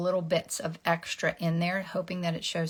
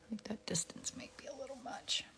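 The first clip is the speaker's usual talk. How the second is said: whispering; 5 semitones higher, 13 dB quieter than usual. Real, not acted. Whispered aside.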